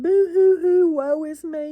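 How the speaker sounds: hammed-up fake sobbing, high and loud; boo-hoo it like a pouty crybaby, then sigh out the woe; mock self-pity wail; mock whining. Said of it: "I'm whining".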